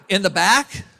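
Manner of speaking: loud, rising outraged question; mock the doctor's shocked indignation, laughing; hammed-up outraged doctor; indignant disbelief. Doctor outraged at being moved.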